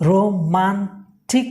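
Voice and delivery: রোমান্টিকলি slowly split into ro, man, tik, lee; syllable-by-syllable pronunciation. Shown as a demonstration. Teacher showing the phonetic symbols, রোমান্টিকলি broken into slow syllables. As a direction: pull রোমান্টিকলি apart with gaps, A silent. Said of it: "উচ্চারণটা শিখিয়ে দিয়েছি".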